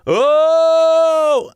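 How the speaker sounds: loud high strained oh; strained high note. Shown as a demonstration. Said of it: "I can't get there".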